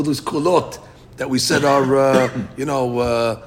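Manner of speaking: ordinary talk, nothing marked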